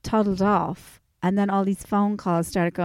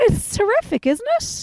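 sarcastic squeal; 13 semitones higher than usual. Real, not acted. Sarcastic high voice.

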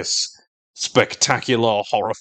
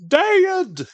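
mock yell of Dad, much higher, cracking mid-word; voice breaking yell. Son calling to his impaled father, voice breaking. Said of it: "his voice breaks in the middle".

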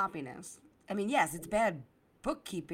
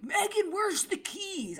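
much higher, slow mock dad call; seventies dad; 12 semitones higher, slower than usual. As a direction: imitate a gruff seventies dad hollering for keys. Dad voiced in her story.

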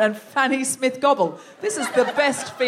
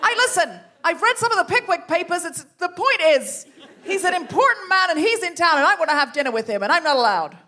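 huffy defence; 6 semitones higher than usual. Acted. Jenny June defends herself.

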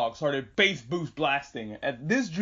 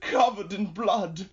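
high, slow, drawn-out melodrama; milk each word like a hammy actor; mock dramatic; 5 semitones higher, slower than usual. Wounded deer to the rabbit who found him, mock dramatic. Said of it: "being dramatic about it".

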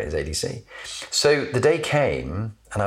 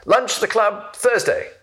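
much higher, clipped command voice; a ferocious general's summons; curt old general; 12 semitones higher, 3 dB louder than usual. Retold or imitated. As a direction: bark an order like an old officer. Retired general's curt invitation.

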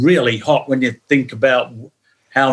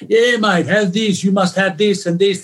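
higher, eager voice of a Greek club sponsor handing out money; mock generous club donor; 6 semitones higher than usual. Teller imitating a Greek club sponsor giving money, higher, eager. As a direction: play the keen sponsor offering everything, pitched up.

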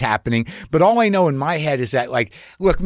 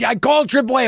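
eager fan voice; 9 semitones higher, faster than usual. Retold or imitated. Fan reports calling AAA.

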